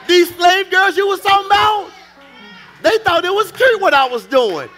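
high, loud, indignant preacher acting out a quarrel; full-force preaching. Husband firing back at his critical wife, full-force preaching. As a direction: shout it as a husband who feels unappreciated.

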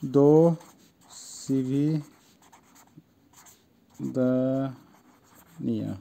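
farewell in slow separate syllables, long pauses. Shown as a demonstration. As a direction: break "দো CV দা নিয়া" into pieces, falling at the end. Russian goodbye broken into syllables. (ভেঙে ভেঙে ধীরে বিদায়)

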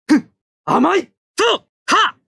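high, forceful attack shouts; battle cries. Acted. Pilot attacking the enemy mobile suit, high forceful shouts. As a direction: yell them as each shot goes out.

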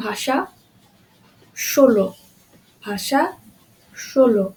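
ভাষা, ষোলো as "bhasha, sholo", word by word; palatal sh. Shown as a demonstration. Teacher drills examples apart, ভাষা, ষোলো with sh. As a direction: punch ষোলো with sh, ভাষা with sh too. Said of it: "তালিবস্যর মতো উচ্চারণ হচ্ছে".

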